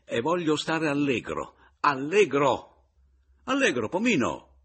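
loud, high, mounting jubilation; exuberant cheer. Acted. Man alive again rallies his rival, exuberant shouting. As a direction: stretch the repeated word into a ringing cry. Declaring he wants to be merry.

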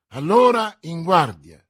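loud order to start the duel; ringing, forceful command; commanding call. A narrator's reading. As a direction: call the swordsmen to arms. Duellist gives order to start combat.

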